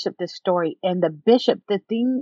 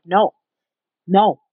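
louder, curt angry refusal; 7 dB louder than usual. Retold or imitated. Bishop rejecting her plea for the relationship, angry. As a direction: imitate a firm, angry no. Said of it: "like, so angry".

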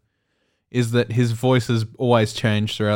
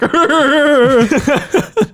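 high loud imitated cartoon ape laugh; Donkey Kong laugh; 7 dB louder than usual. Imitating the cartoon Donkey Kong laugh, high and loud. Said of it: "He's like".

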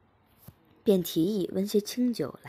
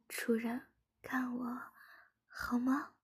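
soft coaxing plea; 6 semitones higher, 7 dB quieter, slower than usual. A narrator's reading. Coaxing her master.

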